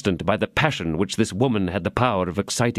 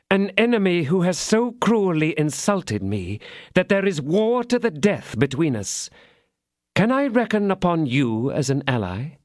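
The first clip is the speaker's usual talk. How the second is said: a lightly voiced scheming lady, higher; vindictive lady; 9 semitones higher than usual. The lady recruiting D'Artagnan, lightly voiced vindictive.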